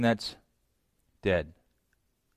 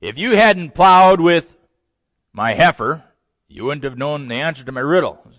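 angry retort; 8 dB louder than usual. Retold or imitated. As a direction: bite out the accusation. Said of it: "Samson gets a little bit mad".

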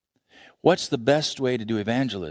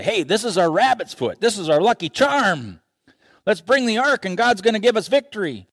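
cocky Israelites; 7 semitones higher than usual. Acted. Israelites boasting about lucky charm.

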